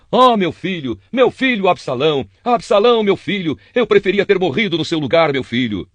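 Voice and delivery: a father keening for his dead son, voice lifted high; anguished wailing. Acted. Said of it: "começou a chorar ... e gritava".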